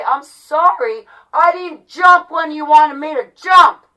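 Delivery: sarcastic apology building to a shout; angry, hammered apologies rising in volume; exasperated shouting. Real, not acted.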